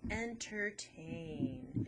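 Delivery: entertain very slow, each syllable drawn out. Shown as a demonstration. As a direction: stretch every syllable of entertain. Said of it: "has three syllables".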